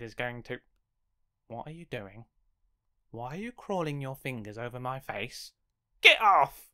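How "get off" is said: loud get off; sharp cry. Acted. Character yelling as something crawls over his face. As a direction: push the creepy fingers away.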